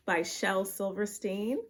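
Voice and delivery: ordinary delivery, nothing marked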